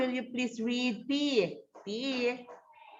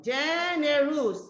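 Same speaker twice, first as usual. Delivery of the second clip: slow syllable demo; 3 semitones higher, slower than usual. Shown as a demonstration. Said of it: "how many syllables are there in generous".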